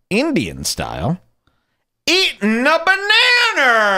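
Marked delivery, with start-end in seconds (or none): 2.0-4.0 long high shouted punchline; hammed-up slow, high shout; punchline yell. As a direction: bellow it out, drawn out and high. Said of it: "I did my famous banana bit".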